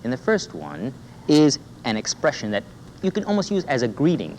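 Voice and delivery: ordinary talk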